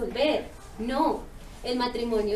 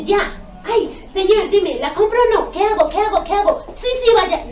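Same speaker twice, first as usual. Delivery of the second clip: loud, high, impatient begging; mimicking a panicky, demanding prayer; mock frantic pleading; 6 semitones higher, 9 dB louder than usual. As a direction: hammer God with urgent questions, hammed-up. Mimics impatient demands for God's answer.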